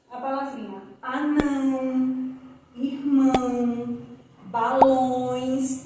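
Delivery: nasalize the ão and õe clearly; teacher modelling nasal sounds; nasal vowel demo. Shown as a demonstration. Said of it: "ele tá saindo nasal, falando assim pelo nariz".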